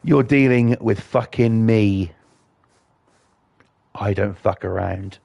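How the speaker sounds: normal talking voice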